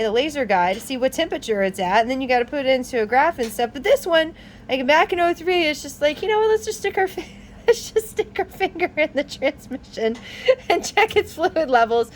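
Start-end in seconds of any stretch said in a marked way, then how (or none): 6.2-12.1 high, amused imitation of daft designers; laughing mock quote. Truck makers deciding on a dipstick, mocked while laughing. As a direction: voice their silly idea through giggles.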